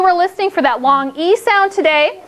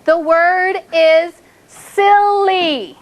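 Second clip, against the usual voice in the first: slow, stretched; much slower than usual. Shown as a demonstration. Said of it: "teacher stretching the word slowly".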